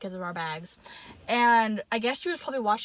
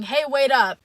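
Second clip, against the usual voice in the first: mock call, much louder; man calling out; 3 semitones higher, 10 dB louder than usual. Store worker chasing the girls, loud call. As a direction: call after them to stop. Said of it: "a man running after me saying".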